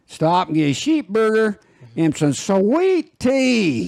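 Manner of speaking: country drawl. Shown as a demonstration. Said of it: "when them country guy can say it".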